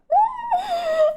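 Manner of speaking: high shrieking laugh-scream; real squeal of joy; excited scream. Real, not acted. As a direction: shriek with delight at the screen. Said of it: "And I screamed over it!".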